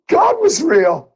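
high, slow, drawn-out wonder; amazed and overjoyed; awed exclamation. Real, not acted. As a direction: let the wonder spill out.

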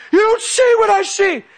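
very high, loud imitated yell; screaming. Son screaming at dad who tries following, imitated yell. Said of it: "screaming at me".

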